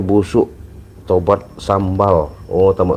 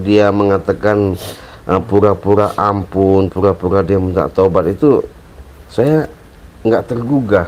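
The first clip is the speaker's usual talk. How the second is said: usual speaking voice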